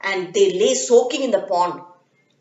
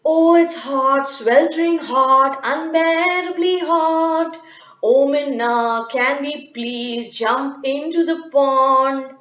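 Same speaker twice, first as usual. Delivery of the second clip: slow, whiny monkeys begging in the heat; 3 semitones higher, slower than usual. Acted. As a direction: drag out the heat like a tired monkey. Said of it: "they said".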